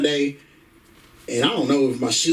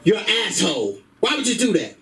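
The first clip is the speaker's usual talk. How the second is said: higher-pitched, scolding girlfriend impression; mock-outraged woman's voice, raised in pitch; 5 semitones higher than usual. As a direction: tell him off as an indignant girlfriend.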